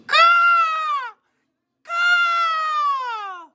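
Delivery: loud, very high squawking caw; crow caw. Pretend. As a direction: screech it like a crow. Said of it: "this is what the crow sounded like".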